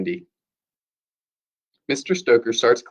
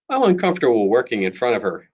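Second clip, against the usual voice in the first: plain reading voice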